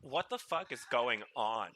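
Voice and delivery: loud, relieved outburst at a talking animal; relieved surprise. Retold or imitated. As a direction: blurt it out, half thanks half shock. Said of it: "I'd be like".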